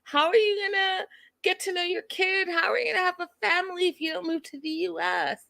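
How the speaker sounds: high, whining mock cry; mock-tearful high voice; whiny Ariana. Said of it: "And she's like".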